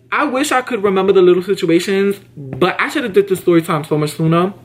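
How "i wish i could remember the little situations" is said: ordinary talk, nothing marked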